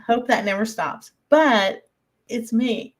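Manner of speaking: usual speaking voice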